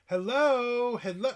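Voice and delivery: high, loud singsong hello; imitated caller. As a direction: mimic her calling at the door, drawn out. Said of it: "And then she's like".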